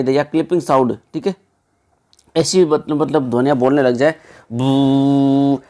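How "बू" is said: a short, cut-off "बू" with the end of the sound chopped; clipped sound demo. Shown as a demonstration. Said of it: "इस प्रकार के जब बोलते हैं उसको बोलते हैं क्लिपिंग साउंड".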